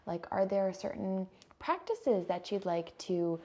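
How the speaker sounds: normal talking voice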